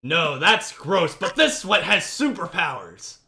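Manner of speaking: infomercial voice. Pretend. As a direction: hype the product like a TV ad. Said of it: "my infomercial voice".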